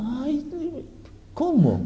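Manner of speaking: suspiro agudo y suave de admiradora, en burla; fan babeando. Acted. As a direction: imita a una mujer derretida. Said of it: "las mujeres babean". (voz aguda de fan embobada, fingida)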